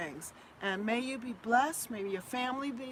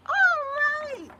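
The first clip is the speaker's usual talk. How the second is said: sudden high loud all right; squealed all right; excited cry; 10 dB louder than usual. Real, not acted. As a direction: burst out with delight. Excited high reading of chat.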